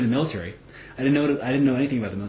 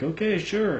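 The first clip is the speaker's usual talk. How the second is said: high agreeable; 9 semitones higher, slower than usual. Retold or imitated. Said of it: "I'm like".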